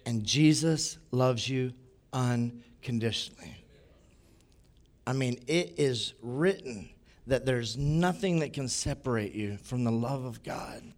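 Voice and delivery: hushed sincere reassurance; tender reassurance. Retold or imitated. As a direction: speak love softly to him. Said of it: "was to say".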